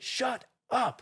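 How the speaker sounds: high annoyed shut up; mock snap. To the confessing teammate, high mock snap. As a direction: tell him off, high and sharp. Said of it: "I just chewed him a new one".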